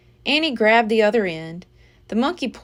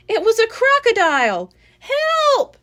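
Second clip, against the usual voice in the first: high, loud panicked scream; girl screaming for help; 11 semitones higher, 5 dB louder than usual. A narrator's reading. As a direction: cry out in terror at the crocodile. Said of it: "screamed Annie".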